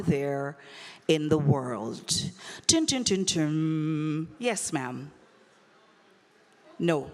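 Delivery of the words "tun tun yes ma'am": normal talking voice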